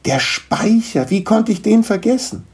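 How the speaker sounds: high-pitched, drawing out the first words; excited realisation. Acted. Niklas remembers the attic, excited. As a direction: exclaim it as the idea lands. Boy has a sudden idea.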